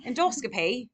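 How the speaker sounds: lightly put-on British "Endoscopy", ending in a rising "pay"; mock British accent. Shown as a demonstration. Teacher showing students how to say it, in a light British accent. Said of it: "say it in a British accent".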